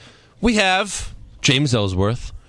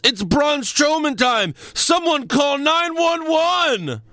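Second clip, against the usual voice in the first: high, drawn-out mock announcer yell; imitated commentator yell; 12 semitones higher, slower than usual. Commentator calling the monster's attack at the end of Raw, imitated yell. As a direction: imitate a frantic wrestling commentator, pitched way up and stretched. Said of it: "he yells".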